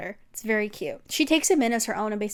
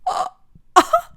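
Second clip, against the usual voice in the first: shocked high shriek; 6 dB louder than usual. Real, not acted. Shocked reaction to line.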